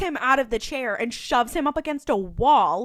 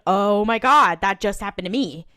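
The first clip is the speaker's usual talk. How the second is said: retold gasp of recognition, louder at the start; mock shock; 7 dB louder than usual. Cop seeing the paper, mock shock. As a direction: punch the opening, lightly shocked. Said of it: "Diana is looking in shock at the paper".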